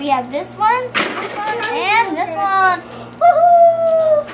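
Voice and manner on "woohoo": high, loud "woohoo"; excited cheer. Real, not acted. Child done showing three glass birds, excited whoop.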